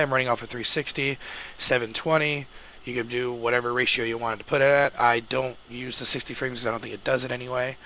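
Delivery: usual speaking voice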